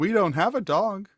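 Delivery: high-pitched literal-minded woman; townswoman. Acted. Wife takes visitor's joke literally, high voice.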